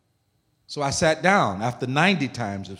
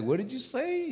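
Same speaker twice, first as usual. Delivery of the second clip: baffled question; 12 semitones higher, 8 dB quieter than usual. Retold or imitated. Confused reply to thick accent.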